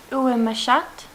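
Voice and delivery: put-on schoolgirl French, said carefully in a French accent; French phrase. A narrator's reading. Alice tests her French on the mouse, French accent. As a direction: try out lesson-book French on the mouse, French accent. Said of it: "first sentence in her French lesson book".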